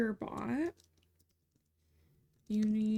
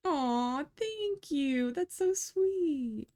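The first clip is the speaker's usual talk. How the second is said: a slow, sweet aww of thanks; sympathetic aww; 5 semitones higher, much slower than usual. Real, not acted. Viewer says something kind.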